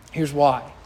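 ordinary talk

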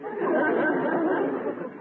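high, sheepish chuckle; sheepish laugh. Acted. Customer laughs off the druggist's joke, high sheepish chuckle.

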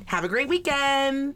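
high, drawn-out sing-song sweetness; mock-cheery sign-off. Comedian closing her curt reply to a commenter, mock-sweet. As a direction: sign off with exaggerated fake cheer.

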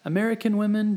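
ordinary delivery

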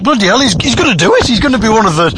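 high-pitched retold astonishment; amazed disbelief. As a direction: exclaim it in rising disbelief. Retold amazed thought at the act.

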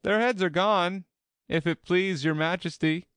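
high, loud soldier's report; loud, high-pitched dutiful shout; shouted reply. A narrator's reading. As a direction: shout the report back to the queen. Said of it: "the soldiers shouted in reply".

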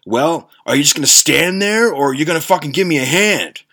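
mock the creepy guy's impatient demand; gruff ogre guy. Ogre guy dragging the bag asks for help, lightly voiced taunt. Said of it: "the fucking ogre guy looks at me and says".

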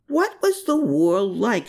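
slow, high, spelled-out question; exasperated repeat, slow and pointed. A narrator's reading. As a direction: lean on was, fed up. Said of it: "she cried out in exasperation".

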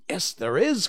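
put-on lifted, emphatic boyish cry. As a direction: cry it out, high and loud. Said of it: "cried Peter".

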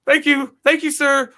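high, loud hammed-up gratitude; mock grovelling thanks. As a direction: grovel to the cop in a squeaky voice. Bowing to the cop.

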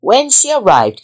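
usual speaking voice

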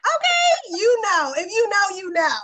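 high, loud knowing tone; knowing sass. Real, not acted. Host teasing the guest with a knowing, loud sass. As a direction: say it like an inside joke.

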